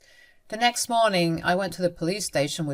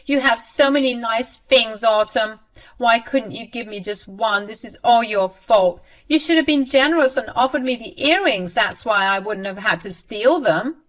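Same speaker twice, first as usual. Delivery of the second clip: higher, louder accusing whine; blaming mother-in-law; 4 semitones higher, 5 dB louder than usual. Acted. Mother-in-law blaming her daughter-in-law, high and petulant. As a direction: shift the blame in a huff.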